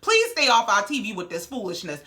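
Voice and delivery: ordinary talk, nothing marked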